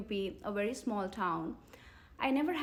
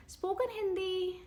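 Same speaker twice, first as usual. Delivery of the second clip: surprised echo; 8 semitones higher than usual. Real, not acted.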